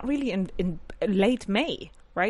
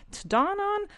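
puzzled question; 13 semitones higher than usual. Retold or imitated. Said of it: "You're like".

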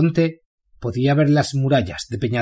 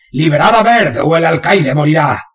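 loud, higher threatening yell; shout; 5 semitones higher, 7 dB louder than usual. Acted. Bane shouting his demand to the prison guards. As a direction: bellow the ultimatum at the walls. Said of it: "y gritó".

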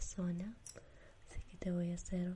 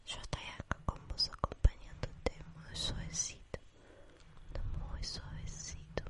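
sleep story narrator; 3 semitones lower than usual. Acted. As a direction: lull them to sleep.

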